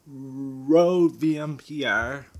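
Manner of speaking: Rode V M P R, each letter slow and separate; slow, spelled out. Real, not acted. Spelling out mic name slowly.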